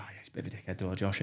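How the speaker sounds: hushed, voiceless whisper of gossip behind his back. Retold or imitated. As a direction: whisper it like passing on a rumour. Gossip about him behind back.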